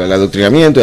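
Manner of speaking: ordinary talk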